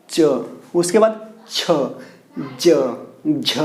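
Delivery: च, छ, ज, झ said slowly and separately from the palate; slow palatal drill; letter sound demo. Shown as a demonstration. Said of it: "आप बोल करके देख लीजिए".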